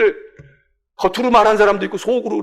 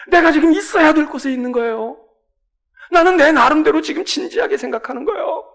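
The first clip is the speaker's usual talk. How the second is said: higher, louder earnest son; earnest protest; 6 semitones higher, 5 dB louder than usual. Acted. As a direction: insist on his calling. Jesus answering parents earnestly.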